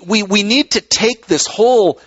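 usual speaking voice

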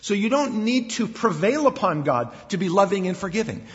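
normal talking voice

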